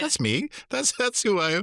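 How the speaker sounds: high, delighted reveal; proud reveal. Retold or imitated. Young helper telling the mother he is Eddie Spaghetti, delighted. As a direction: announce it with playful pride.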